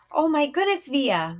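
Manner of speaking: high, loud burst of joy; excited scream. A narrator's reading. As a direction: gush over her daughter. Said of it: "Mom was screaming with excitement".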